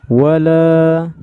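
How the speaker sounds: "wala" chanted with the last a held two beats; two-count long vowel. Shown as a demonstration. Teacher showing how it is read, "wala" with a measured long a. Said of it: "kita memanjangkan satu alif".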